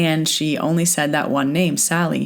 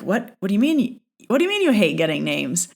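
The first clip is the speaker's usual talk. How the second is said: taken aback; 4 semitones higher than usual. Retold or imitated. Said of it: "I was taken aback".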